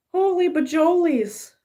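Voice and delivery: high, amazed outburst; surprised exclamation. Real, not acted. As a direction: gasp it out in wonder. Sudden realization exclamation.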